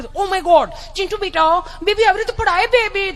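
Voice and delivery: put-on high, doting gasp over the baby; fussy mother. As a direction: fuss over him dramatically. Mother fussing over fall. (ગભરાયેલી લાડકી mummy)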